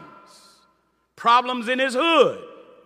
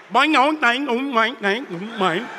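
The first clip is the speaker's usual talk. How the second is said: playful cartoon boings, bouncing each one; boing sound effects. Preacher mimicking the book spreading worldwide, playful boings. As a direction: bounce out springy cartoon boings, the last ones dropping away.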